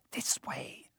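barely voiced, breathy whisper; mouthed whisper. A narrator's reading. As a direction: mouth it silently to the others. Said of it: "Harry mouthed to the others".

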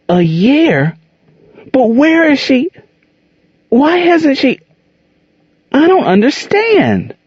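put-on high, shocked child's questions; a boy who can't believe it; stunned boy. A narrator's reading. Boy stunned mother freed long ago.

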